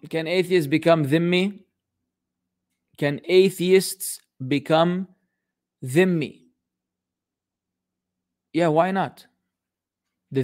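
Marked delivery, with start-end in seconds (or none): 3.0-6.4 slow and deliberate, with gaps between words; half-speed, spaced-out question; slow re-reading. Real, not acted. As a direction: spell the question out word by word. Re-reads the question word by word.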